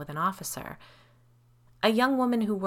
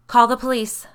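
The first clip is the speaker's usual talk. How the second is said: high, loud urgent shout; 6 semitones higher, 12 dB louder than usual. A narrator's reading. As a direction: shout for help as you burst in. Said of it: "I shouted".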